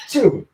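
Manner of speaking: sudden sharp real sneeze; sneezing. Real, not acted. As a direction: let out a loud sneeze. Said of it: "I'm gonna sneeze".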